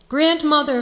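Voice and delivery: high, loud call. A narrator's reading. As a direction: call out to grandmother. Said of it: "called Gerda".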